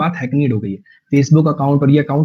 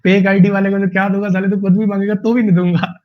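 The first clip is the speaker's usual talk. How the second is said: higher, chuckling as he repeats the line; amused retelling; 4 semitones higher than usual. Retold or imitated. Teacher repeats the meme punchline while amused. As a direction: relish the joke, almost laughing. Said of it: "repeats meme comment, laughing".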